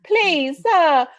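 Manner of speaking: high, playful British begging; British accent. Pretend. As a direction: plead like a British orphan. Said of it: "that's my British accent".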